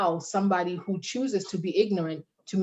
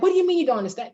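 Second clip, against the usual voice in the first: mock upset retort; 8 semitones higher, 4 dB louder, much faster than usual. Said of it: "'Cause then you're like".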